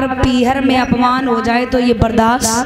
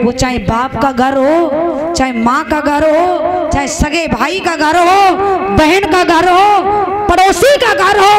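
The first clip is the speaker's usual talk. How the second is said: building sermon cry; 5 semitones higher than usual. Acted. Preacher's list building to climax.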